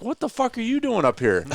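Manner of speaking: high, startled outburst; astonished. Retold or imitated. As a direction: caught totally off guard. Butch recognizing him, stunned.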